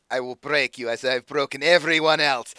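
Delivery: slight threat in a put-on accent; lightly voiced villain accent. Predatory patron threatening the challenger, lightly accented menace. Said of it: "Doing this voice is gonna kill me".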